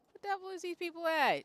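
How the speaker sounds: high, loud indignant cry; indignant outburst. Real, not acted. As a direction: exclaim in loud disbelief.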